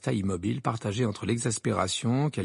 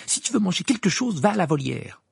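higher-pitched, irritated order; curt dismissal; 7 semitones higher, 4 dB louder than usual. A narrator's reading. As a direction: send the owl off sharply. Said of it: "d'un ton sec". (ton sec, agacé)

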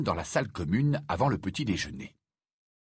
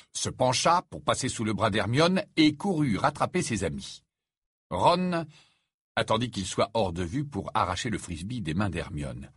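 ordinary delivery, nothing marked

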